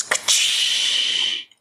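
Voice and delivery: breathy explosion noise; mock spell explosion; whispered blast effect. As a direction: make the magic blast sound.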